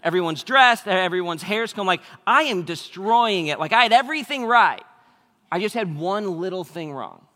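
loud, high, keyed-up storytelling; frantic retelling. Retold or imitated. Pastor telling the congregation about a chaotic morning, loud and keyed-up. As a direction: pile on the chaos, voice climbing.

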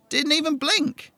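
indignant squeal of disbelief; outraged disbelief. Acted. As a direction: stretch it out in outrage. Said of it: "He scowled".

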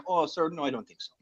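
put-on Mexican accent that slips into Irish; botched Mexican accent. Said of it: "my Mexican sounds like he's Irish".

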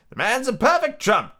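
high, emphatic squire's voice; declaring squire. Acted. Squire praising the cook to the doctor, declaring roundly. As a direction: declare hearty approval as a squire. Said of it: "declared the squire".

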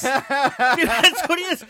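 slow, loud laughing insistence. Real, not acted. Insisting to his co-host while laughing loudly. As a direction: push it out through laughter.

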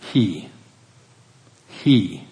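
"He" said alone as the key word; stress on he. Shown as a demonstration. Preacher singling out "He" for the congregation. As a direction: isolate "He" and lean on it. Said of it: "That's where you put the emphasis".

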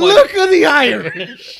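put-on high, loud, drawn-out Irish accent. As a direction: belt out a hammed-up Irish accent. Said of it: "Irish. Do Irish".

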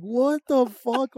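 high, slow dazed mumble of a half-asleep mom; groggy mom waking. Retold or imitated. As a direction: stretch it out, confused and foggy.

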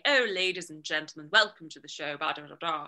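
heightened stage host. Retold or imitated. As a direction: announce grandly as a theatrical character. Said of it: "I was heightening my voice".

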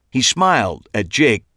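ordinary delivery

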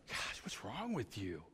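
retold exasperated self-scolding, rising at the end; mock berating of himself. Man scolding himself over a stupid mistake, exasperated self-berating. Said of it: "just saying this to myself".

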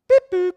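high, loud robotic beeps; beep boop noise. Pretend. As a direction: play a signal going off. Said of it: "he didn't do that noise".

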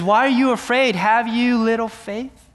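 reprimanding. Acted. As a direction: scold the frightened disciples. Jesus reprimands his disciples.

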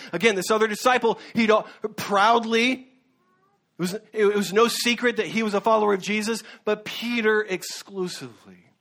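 ordinary talk, nothing marked

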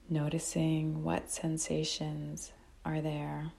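calm, unhurried meditation guide with long pauses; guided meditation. Real, not acted. Teacher guiding listeners to notice breath and body, calm meditation voice. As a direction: guide them gently, phrase by phrase, leaving long silences.

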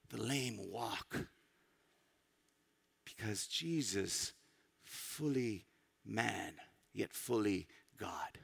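low, soft and very slow; solemn, slow drop in pitch and volume; hushed reverence. Real, not acted. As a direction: let each phrase sink in softly. Preacher lands his point solemnly.

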